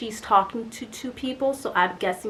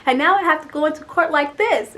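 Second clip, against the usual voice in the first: high-pitched fretful complaint; upset; 5 semitones higher, 4 dB louder than usual. Retold or imitated. Woman explaining to parking guy about forgotten heels, upset. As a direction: fret upward, complaining to a stranger. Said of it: "I'm so upset".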